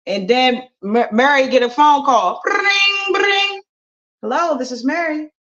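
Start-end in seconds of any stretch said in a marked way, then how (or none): 2.4-3.6 high imitated phone ring. 4.2-5.3 mom on phone. Acted.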